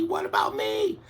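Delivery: very high tiny squeak; squeaky bird. Acted. Last bird begging.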